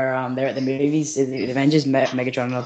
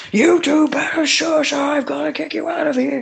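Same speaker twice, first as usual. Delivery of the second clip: high, slow cop voice warning the pair; stern cop voice; 14 semitones higher, 4 dB louder, slower than usual. Acted. Barricade the cop threatening to kick them out, high. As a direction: play the cop, high-pitched, throwing them out.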